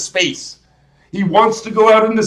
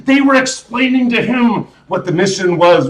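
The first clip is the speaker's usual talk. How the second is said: ordinary talk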